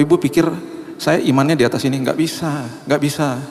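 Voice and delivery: high, intense confessional preaching; impassioned preaching. Acted. Preacher admits his faith faltered, impassioned. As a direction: open your heart to the church with force.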